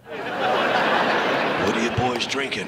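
slow low mock waiter, laughing through it; playing the cop as a waiter taking orders. Drunk man imitating a polite waiter taking drink orders. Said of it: "I thought the cop was a waiter".

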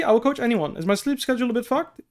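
ordinary talk, nothing marked

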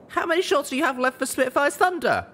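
high, anxious pony voice asking fast; high worried mare. Acted. Heroine anxiously asking Calamity about the rifles, high. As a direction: ask it pitched high with worry. Littlepip asks about ammo.

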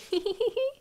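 high, imitated little-kid giggle; childish giggle. Child who splashed mom, mischievous giggle. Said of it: "And I go".

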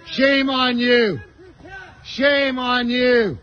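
ordinary talk

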